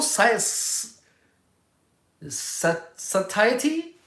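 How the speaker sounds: halting, questioning rise; unsure of word. Real, not acted. Speaker stumbling over an unfamiliar word, unsure. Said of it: "What is that word?".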